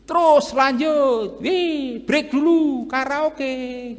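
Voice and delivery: hammed-up partygoer calling a halt; mock careless official. Sanguine council member yelling for karaoke break, mock carefree. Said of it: "dia akan teriak-teriak gitu".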